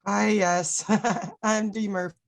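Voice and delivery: normal talking voice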